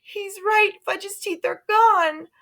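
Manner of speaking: put-on high anxious girl; upset girl. A narrator's reading. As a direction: wail it like a scared girl. Sheila upset, voiced high.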